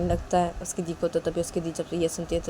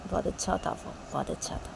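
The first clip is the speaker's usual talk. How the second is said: breathy hush, lost in a crush; soft dreamy whisper; 8 dB quieter than usual. Acted. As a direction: whisper it dreamily, almost to yourself. Ronny gushing about a boy.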